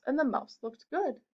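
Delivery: ordinary delivery, nothing marked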